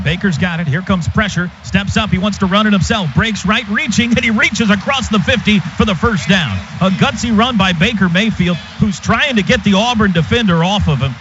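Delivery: usual speaking voice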